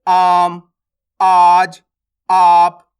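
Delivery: "आम", "आज", "आप" with aa drawn out loud; stressed long aa. Shown as a demonstration. Teacher gives example words, "आम", "आज", "आप" slow and stressed. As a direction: push and stretch the opening vowel of "आम", "आज", "आप". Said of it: "इसका उच्चारण में जोर लगेगा".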